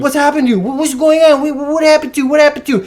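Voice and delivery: high, stammering mock frenzy; imitated wired, worked-up questioning; frantic boss. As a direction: keep firing the question high-pitched. Frantic boss repeats his question.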